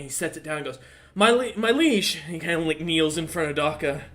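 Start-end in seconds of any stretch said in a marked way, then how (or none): 1.1-2.2 breathless messenger, higher and louder; scrawny orc messenger. Acted. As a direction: burst in gasping out the title. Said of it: "this orc just comes running in".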